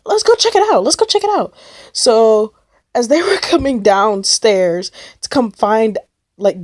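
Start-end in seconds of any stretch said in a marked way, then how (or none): 0.0-1.5 high-pitched mock urging; urgent friends. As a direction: urge the others along in a high imitated voice. Friends decide to investigate.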